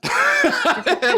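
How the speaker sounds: sudden high, loud laugh; loud high cackle of real laughter; high burst of laughter. Real, not acted. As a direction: crack up out loud. Bursts out laughing at his quote.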